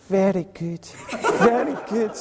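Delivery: high-pitched old Scottish lady, played for laughs; imitated old lady's accent. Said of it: "forgive my accent".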